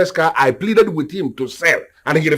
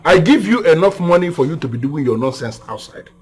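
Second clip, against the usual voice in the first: usual speaking voice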